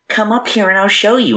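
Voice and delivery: high, fake chipmunk voice; squeaky high-pitched voice. Fake Beth luring the searchers upstairs, squeaky high-pitched imitation. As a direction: imitate a squeaky little lure. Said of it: "in this really high-pitched voice".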